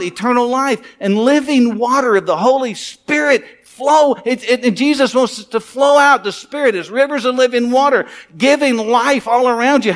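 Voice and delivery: rousing sermon delivery; preaching. Acted. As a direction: preach it with full conviction. Preaching to the congregation.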